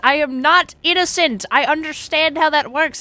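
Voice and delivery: exasperated protest. Real, not acted. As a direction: push back loudly, stretching the first words. Exasperated protest at chat's explanation.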